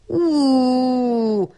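long high wailing "ooh"; drawn-out mock wail; howl. A narrator's reading. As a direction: hoot it out like a howl, sustained. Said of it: "tutult pálinkós Gyurka".

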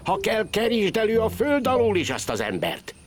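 high-pitched, insistent rich woman's order; urgent command. A narrator's reading.

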